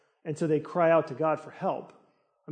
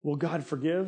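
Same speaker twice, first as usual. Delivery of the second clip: usual speaking voice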